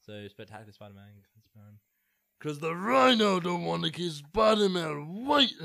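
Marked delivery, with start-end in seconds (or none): 2.4-5.6 loud, slow, bellowing brute voice; rhino. Retold or imitated. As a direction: boom it like a big villain. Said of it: "Can I try the rhino impression".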